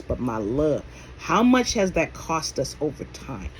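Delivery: ordinary talk, nothing marked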